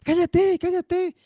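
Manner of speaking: high, sharp hushing; the crowd silencing Bartimaeus, high and sharp; hushing him. Acted. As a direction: shush the blind man harshly. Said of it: "algunos lo callaban".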